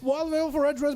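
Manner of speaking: high, urgent esports commentary; excited live match caster; play-by-play. Acted.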